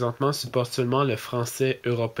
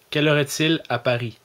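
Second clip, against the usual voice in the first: louder question in his own Québécois accent to the phone; Quebec French accent; 4 semitones higher, 6 dB louder than usual. Shown as a demonstration. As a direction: ask Siri plainly in Quebec French. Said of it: "j'ai corrigé mon ton de voix, mon accent". (accent québécois, plus fort)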